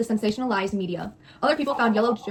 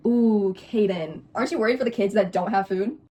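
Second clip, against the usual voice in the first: ordinary delivery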